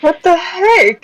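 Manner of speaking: slow, high what the heck; stunned outrage, drawn out; disbelief. Real, not acted. Friend reacting to the barista offer with slow high disbelief.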